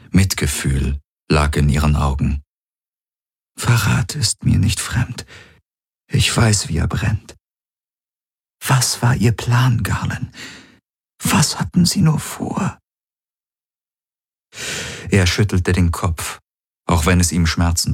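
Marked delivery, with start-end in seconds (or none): 3.6-12.8 high-pitched, wounded questioning; hurt, betrayed woman. A narrator's reading. Ylana questioning Garland about the betrayal, hurt. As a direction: climb higher into a pained, searching question.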